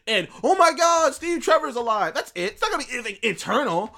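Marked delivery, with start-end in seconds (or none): 0.4-2.1 high, loud fake gasp of joy; hammed-up fan excitement; mock amazement. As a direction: gush it in mock disbelief. Mock reaction to predicted plot twist.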